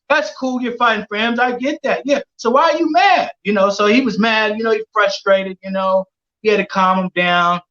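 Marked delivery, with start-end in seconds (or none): 0.1-3.3 high-pitched, pressing question; raised, incredulous pushback; challenging retort. Retold or imitated. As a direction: argue back, conceding then challenging. Arguing back at Tank in story.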